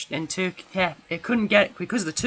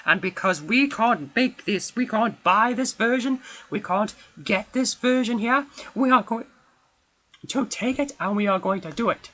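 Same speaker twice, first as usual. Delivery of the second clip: imitating Turkish filmmakers, pitched up and defiant; 6 semitones higher than usual. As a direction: voice them as plucky rebels saying "we'll do it ourselves". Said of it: "and be like".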